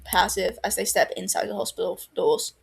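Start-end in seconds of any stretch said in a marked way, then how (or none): none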